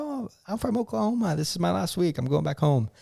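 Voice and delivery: high, soft imitation of a friendly woman; super nice. Check-in lady on her last week, super nice. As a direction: imitate sweet, chatty small talk. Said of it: "super nice".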